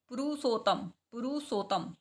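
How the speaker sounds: पुरुषोत्तम as "pu-ru-so-tam"; plain s and single t in पुरुषोत्तम; wrong pronunciation. Shown as a demonstration. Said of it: "wrong।". (पुरुषोत्तम का गलत रूप "पुरुसोतम")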